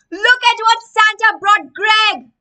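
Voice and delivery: high, loud jeering shout; mocking shout. Boy mocks Greg in the street, high loud jeering shout. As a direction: jeer at him like a taunting kid. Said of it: "shouted one boy".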